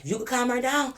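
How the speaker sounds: high-pitched, trailing hint; imitated officer. Officer hinting without finishing, high-pitched imitation. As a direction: imitate him with a high sing-out. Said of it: "This what he was doing to me".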